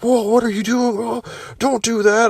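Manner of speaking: high, startled mock cop voice; alarmed cops. Cops see him crack his back, high startled alarm. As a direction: squeak it out like a spooked cop.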